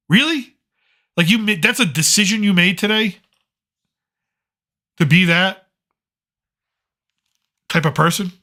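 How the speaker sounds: high, mocking "really?" of disbelief; incredulous disbelief. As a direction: sneer at their choice with exaggerated surprise. Mocking a combative customer.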